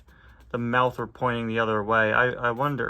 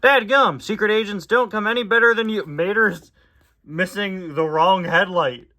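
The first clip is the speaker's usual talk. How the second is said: raised, loud folksy tow truck; Mater voice; 7 semitones higher, 8 dB louder than usual. Acted. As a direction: exclaim it like Mater.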